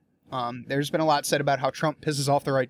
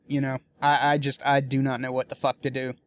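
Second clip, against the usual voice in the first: ordinary talk, nothing marked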